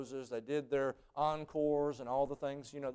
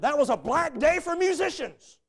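high, loud, theatrical pronouncement; booming conductor's proclamation; 13 dB louder than usual. Retold or imitated. Conductor scolding the soloist who was born on Tuesday, booming proclamation. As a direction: thunder it like a fuming maestro. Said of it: "He said".

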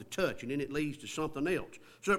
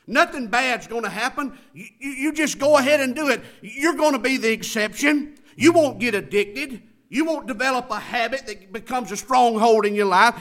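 high, loud mock urging of the tempter; preacher voicing the devil at full force; devil's false promises; 9 semitones higher, 10 dB louder than usual. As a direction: egg them on loudly with mocking assurances. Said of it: "He lies about the consequences".

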